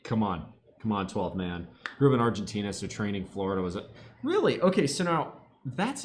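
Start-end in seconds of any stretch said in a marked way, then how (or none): none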